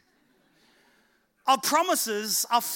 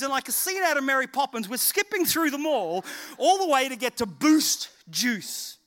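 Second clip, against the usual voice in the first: ordinary talk, nothing marked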